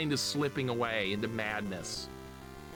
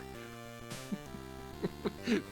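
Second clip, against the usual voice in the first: soft, high wheeze of laughter; rueful laugh; 8 semitones higher, 7 dB quieter than usual. Real, not acted. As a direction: chuckle helplessly at it all. Laughing about old days.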